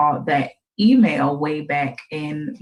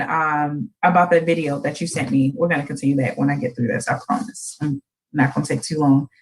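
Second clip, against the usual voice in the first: usual speaking voice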